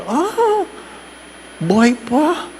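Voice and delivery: high, teasing ah of surprise; playful surprise at the old lady; mock surprise. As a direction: act amazed she's still alive. Priest's mock surprise in story.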